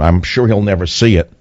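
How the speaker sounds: ordinary talk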